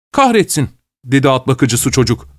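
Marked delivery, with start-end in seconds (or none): 0.1-0.7 high-pitched, startled curse; excited exclamation. Acted. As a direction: blurt out a curse in a raised pitch. Stable boy swears at the chase.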